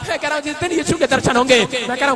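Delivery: loud, high preacher's shout; preacher rousing the women to stand and rejoice; preaching at full force. Real, not acted. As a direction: rouse them to stand and rejoice. Preaching climax.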